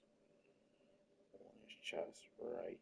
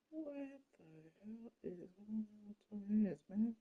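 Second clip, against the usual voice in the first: high, incredulous what the hell; mock shocked; 10 semitones higher, 9 dB quieter than usual. Painting a figure, asking about its two-tone hands in high mock disbelief. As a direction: pitch it up in fake disbelief.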